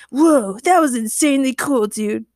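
loud excited admiration; cheering. A narrator's reading. Chad praises James's superhuman leap, cheering. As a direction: gush like an impressed buddy. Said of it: "Chad cheered".

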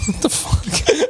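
high-pitched, drawn-out disbelief; incredulous. Real, not acted. Friend reacting to a pointless lie, incredulous. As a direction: stretch it out high in real disbelief.

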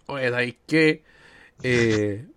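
put-on crude old man voice; lightly voiced raunchy old geezer. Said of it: "¿Pero por qué con esa voz?".

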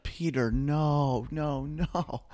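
high, slow pleading mother impression; imitated dismayed mom. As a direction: drag it out like a mom begging her son. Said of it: "she was like".

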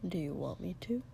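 whispered offer. A narrator's reading. Shoto gently offering Katsuki, whispered. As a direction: barely breathe the question. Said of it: "Shoto said quietly".

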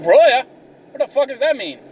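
high-pitched, puzzled comeback; indignant bafflement. Retold or imitated. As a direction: act out being thrown by the word.

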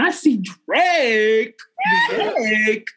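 slow, stretched squeal of the name; hearing her crush will be at the club, excited squeal; excited disbelief. Retold or imitated. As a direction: savour the name in giddy shock. Shocked to hear [name] is coming.

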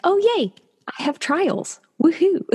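high, squealing fake cheer; mock cheerful. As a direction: squeal it with put-on delight. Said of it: "instead of being like Jen".

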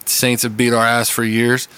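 usual speaking voice

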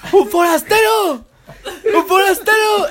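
high-pitched alarm cry; hammed-up villager yell; Resident Evil villager. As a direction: call out the intruder like a game villager. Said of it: "es una referencia a Resident Evil cuatro".

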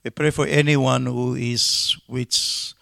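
subdued pastoral prayer, with pauses. Real, not acted. Leads a prayer.

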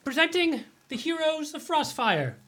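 high, ringing herald's call; herald's announcement. Acted. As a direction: announce them to the whole hall. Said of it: "Sir Leo's then presenting".

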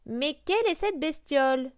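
high-pitched mocking question; mocking hen. A narrator's reading. Hen spots the grey duckling, mocking him. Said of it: "se moqua la poule".

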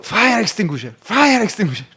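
imitating the crew member shouting for a fire extinguisher; urgent call for help. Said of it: "και ακούω".

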